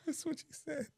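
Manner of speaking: squeaky high mocking voice. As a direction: echo it in a high whiny voice. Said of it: "I never said you look ugly".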